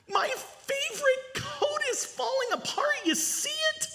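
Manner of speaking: put-on boy showing the stranger his falling-apart coat; whiny boy voice. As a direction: play the upset little boy. Boy voiced in children's story.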